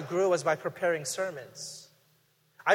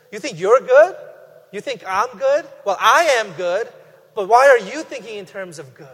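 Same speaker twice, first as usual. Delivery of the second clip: louder, pointed challenge in Jesus's words; challenging questioning; 3 semitones higher, 9 dB louder than usual. Acted. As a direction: push back hard, with raised pitch and volume. Voices Jesus challenging the rich ruler.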